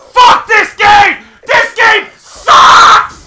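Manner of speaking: very loud, high, slow yell; raging scream. Real, not acted. Gamer losing as time runs out, raging scream. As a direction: scream it, dragging out each word. Player losing match rages.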